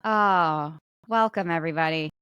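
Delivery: ordinary talk, nothing marked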